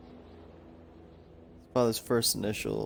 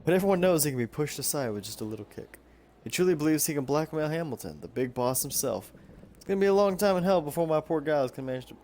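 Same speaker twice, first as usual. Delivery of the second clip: put-on Southern accent; 4 semitones higher than usual. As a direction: slip back into a light Southern twang. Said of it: "I keep going Southern".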